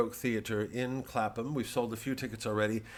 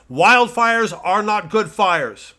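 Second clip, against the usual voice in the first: slow, loud, higher, every word hammered; emphatic; 10 semitones higher, 14 dB louder, much slower than usual. Acted. Pundit insisting to viewers, slow and emphatic. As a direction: pound each word home.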